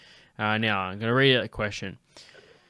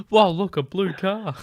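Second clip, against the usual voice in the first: mock impressed voice; 9 semitones higher, 4 dB louder than usual. Mocking the unimpressed old man.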